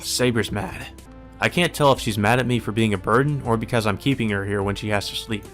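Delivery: ordinary talk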